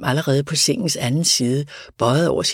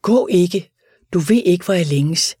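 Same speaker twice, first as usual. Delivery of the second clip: pleading; 4 semitones higher, 5 dB louder than usual. A narrator's reading. Dying man begs coachman stay.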